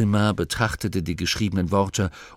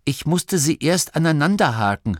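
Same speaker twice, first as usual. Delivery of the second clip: higher, slightly raised apologetic call; rufend; 7 semitones higher, 4 dB louder than usual. A narrator's reading. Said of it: "rief Masklin". (entschuldigend herabgerufen)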